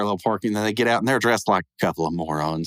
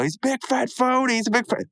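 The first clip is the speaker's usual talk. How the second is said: much higher singsong taunt; Family Guy bit; 12 semitones higher than usual. Retold or imitated. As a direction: chant it like a mocking kid. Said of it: "one of those Family Guy".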